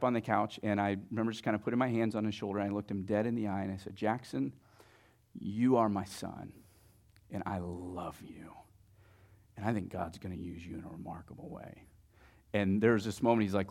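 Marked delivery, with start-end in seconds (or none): 3.9-11.8 low, hushed, sincere words; tender blessing. Retold or imitated. Dad blesses his son face to face, tenderly.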